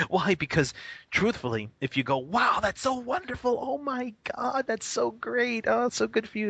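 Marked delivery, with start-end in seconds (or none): 2.2-6.5 hammed-up adoring praise in a much higher voice; mock gushing praise. Acts out over-the-top praise of student.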